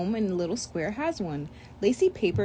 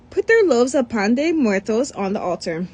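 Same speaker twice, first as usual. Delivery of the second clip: usual speaking voice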